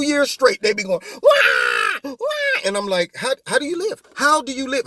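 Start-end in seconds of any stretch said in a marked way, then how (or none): 1.2-2.6 mock crying. Host mocking political opponents throwing fits, fake baby wail. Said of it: "snowflakes running around throwing fits". 3.2-4.9 baffled question. Retold or imitated. Baffled retort to the criers.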